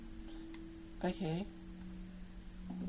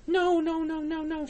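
high, loud string of noes; panicked refusal; 14 semitones higher, 7 dB louder than usual. Real, not acted. Player spotting danger in a horror game, panicked. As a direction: blurt it in rising panic.

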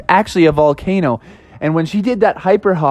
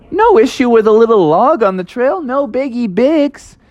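higher, carefree figure voice; breezy cousin's thought; 6 semitones higher than usual. Acted. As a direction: shrug it off lightly in a raised voice. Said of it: "And he thought".

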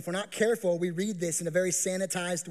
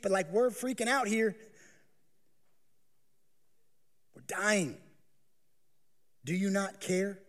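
a touch higher, hesitant and timid; mock polite plea; 6 semitones higher than usual. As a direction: ask politely while scared. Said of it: "maybe even quieter to be a little polite".

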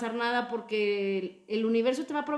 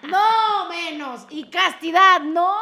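very loud, high, hammed-up refusals; mock horror; 7 semitones higher, 12 dB louder, slower than usual. Refusing the nun's vows to the co-host, loud mock protest. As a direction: shout down each vow in playful horror.

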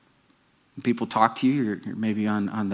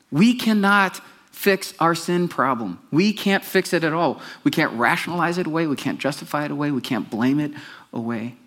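fervent preaching; 5 semitones higher than usual. Acted. Preaching build.

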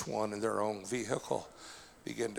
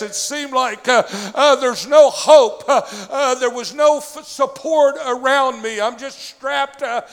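loud, high preaching shout; preaching at full force; 12 semitones higher, 18 dB louder than usual. Real, not acted. Pastor telling the congregation about his trial, full-force preaching. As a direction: preach it to the rafters, voice climbing.

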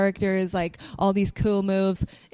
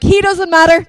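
loud, high-pitched dismissal; retold blurt; 9 semitones higher, 12 dB louder than usual. Retelling meeting Seth Rollins, loud excited blurt. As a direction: blurt it out much louder and higher.

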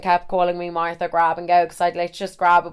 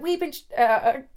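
high attempted American accent; American accent falling apart mid-line; American guy; 10 semitones higher than usual. American guy arguing back, botched high-pitched American accent. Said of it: "And then the other guy's like".

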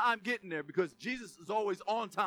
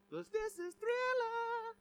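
high, slow, drawn out; slower than usual. Pretend. Preacher joking the dead would rise like Thriller, high and slow. Joke about zombies rising.

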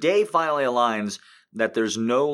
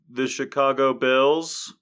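higher, louder puzzled guess; dumb quiz answer; 5 semitones higher, 5 dB louder, slower than usual. Retold or imitated. Imagined Jeopardy contestant botching the team name. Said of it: "I'd be all like".

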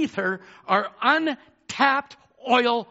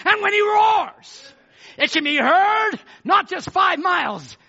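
high, loud proclamation; full-force preaching; 10 semitones higher, 5 dB louder than usual. Real, not acted. Preacher rallying church to roar with the lion, full-force preaching.

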